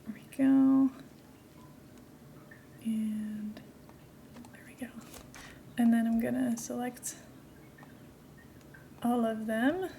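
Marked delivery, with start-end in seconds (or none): 2.8-4.9 soft hushed whisper. Shown as a demonstration. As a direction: breathe it in a whisper. Repeats phrase in a whisper.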